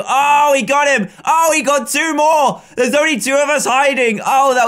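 high, loud gamer yell of delight; sudden excited yelling; excited shout. Real, not acted. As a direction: cheer the catch out loud. Hider caught, excited outburst.

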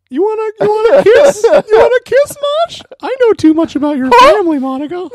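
high, loud squeaky voice. Retold or imitated. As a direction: shout it like a cartoon mouse. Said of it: "Is that a good impression?".

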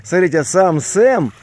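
ordinary talk, nothing marked

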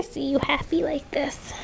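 soft whisper aside. Real, not acted. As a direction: whisper it tenderly. Hushed aside to pet.

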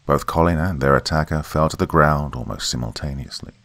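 ordinary delivery

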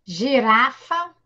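slow shared demo; syllable by syllable together. Shown as a demonstration. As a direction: say gi-ra-fa along with the kids. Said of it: "Vamos lá".

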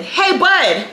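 high-pitched sing-out to a coworker; office lady calling over. Retold or imitated. Office lady calling to Bud's table, casual hail. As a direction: voice a lady hailing her colleague.

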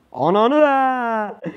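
high, slow frustrated curse; drawn-out angry curse. Real, not acted. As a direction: stretch it out in exasperation. Angry outburst at game.

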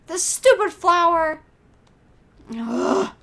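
loud, high exasperation; frustrated outburst. Real, not acted. Player curses the flower character, loud frustration. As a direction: spit it out loudly, then groan.

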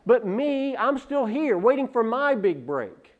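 mock-pitiful grumble to the congregation, rising and loud; self-pitying lament. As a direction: whine about everyone else's luck, voice climbing. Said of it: "developing an attitude of".

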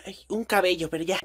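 ordinary talk

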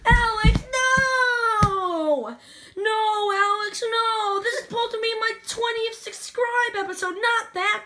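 loud, high-pitched exasperated yelling; protesting shout. Real, not acted. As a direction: yell at a brother ruining your episode. Protesting as Alex disrupts the episode.

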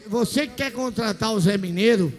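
usual speaking voice

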